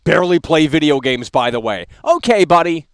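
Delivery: loud scornful kick-off; angry dismissal. Real, not acted. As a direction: throw him off the show. Kicking caller off air.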